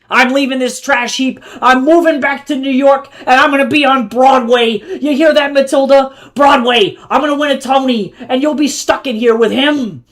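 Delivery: high, loud wounded shouting; pained shouting. A narrator's reading. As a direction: storm out shouting your grand exit. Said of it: "the pain in his voice didn't sound simulated".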